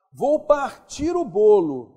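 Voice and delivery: a teacher's slow, loud model sentence; slow emphatic example. Shown as a demonstration. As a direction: lay out partir slowly so the last syllable stands out. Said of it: "Oxítona terminada em R".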